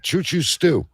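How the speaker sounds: normal talking voice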